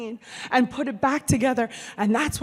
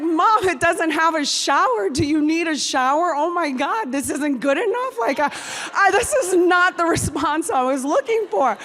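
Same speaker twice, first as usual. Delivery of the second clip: loud, high-pitched offended outburst; exasperated indignation; 7 semitones higher, 8 dB louder than usual. Retold or imitated. Daughter to mom after the shower question, exasperated. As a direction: protest loudly in mock outrage.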